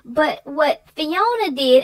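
ordinary delivery, nothing marked

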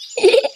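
high short giggle; quick high snicker. Acted. Giggle after flirting.

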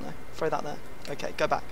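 usual speaking voice

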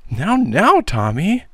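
high-pitched, shocked aunt; horrified exclamation. A narrator's reading. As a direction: exclaim in alarm, voice shooting up. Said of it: "exclaimed the horrified and too credulous aunt".

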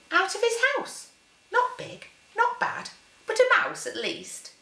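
high, delighted little voice; high child voice. Acted. The Gruffalo's child seeing the mouse, high and eager. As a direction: spot a tasty snack.

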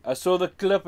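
usual speaking voice

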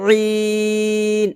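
রিন as throaty ee held very long; long pharyngeal ee in রিন; three-count ayn. Shown as a demonstration. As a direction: squeeze and sustain the vowel of রিন. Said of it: "এখানে তিন আলিফ টান দিব".

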